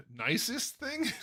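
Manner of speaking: high, drawn-out questioning repeat; incredulous echo. Real, not acted. Streamer repeating a viewer's question about his nicest deed, incredulous.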